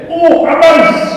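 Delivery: shrill high shriek, slow and loud; old lady shrieking at a mouse; frightened shout. Retold or imitated. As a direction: squeal at the sight of a mouse. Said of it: "she shouted out".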